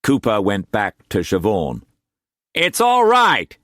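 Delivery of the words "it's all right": high, slow character voice, falsely calm; lying reassurance. A narrator's reading. Cooper reassuring Siobhan in the storm, high, slow. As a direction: voice the lie as comfort. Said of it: "he lied".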